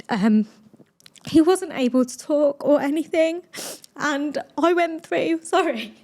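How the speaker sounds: fighting back tears mid-sentence; getting emotional. Real, not acted. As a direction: voice rising and catching as tears come. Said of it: "Sorry, I'm getting emotional".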